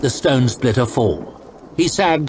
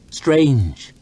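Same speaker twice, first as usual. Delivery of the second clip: plain reading voice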